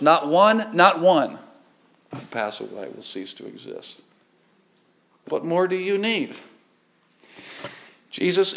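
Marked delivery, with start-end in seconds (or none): 0.0-1.4 "Not one, not one" punched out loud; preacher's forceful "not one"; emphatic repetition. Acted. Preacher hammering scripture's point. 2.1-4.0 hushed drop. Acted. Dropping after emphatic repetition.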